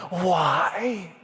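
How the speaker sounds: high, drawn-out cry of despair; anguished cry. Acted. Job crying out to God in loss, anguished. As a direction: stretch the word upward in anguish. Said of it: "he cries out to God".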